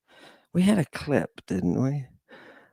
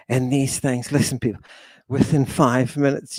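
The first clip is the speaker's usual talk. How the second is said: ordinary talk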